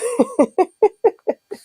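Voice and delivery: a burst of high, real laughter; high giggle. Real, not acted. Giggling at the box she just got. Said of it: "I don't know why I'm laughing".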